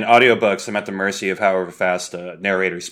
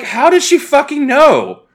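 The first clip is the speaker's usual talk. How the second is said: incredulous; 5 dB louder than usual. Real, not acted. Incredulous rant about friend's insight.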